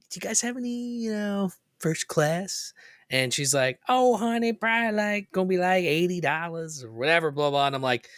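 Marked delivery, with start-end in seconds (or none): 0.1-2.7 re-enacting a high, wheedling request; coaxing polite ask. Asking the airline lady for first class, high coaxing voice. Retelling his sweet-talked upgrade request. 3.9-7.7 high-pitched imitation of a Southern lady. Airline lady quoting an upgrade price, high Southern-lady voice. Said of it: "she was very Southern".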